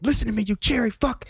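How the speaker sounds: high mock commander insult; mock yelling commander. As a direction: bark the insult at the rookie.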